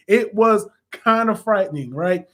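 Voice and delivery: usual speaking voice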